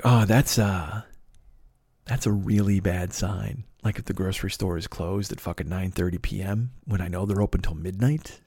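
subdued worry. Retold or imitated. Alone outside the closed store, subdued worry.

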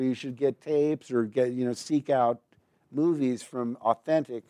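ordinary talk, nothing marked

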